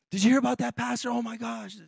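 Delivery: mock the thrill of spreading a scandal; scandalized gossip. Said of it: "you're talking about a different church".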